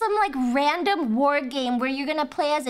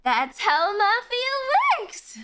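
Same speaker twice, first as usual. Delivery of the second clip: mock ad line; 6 semitones higher, slower than usual. Mock quote of ad's line.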